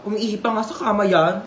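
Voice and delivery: usual speaking voice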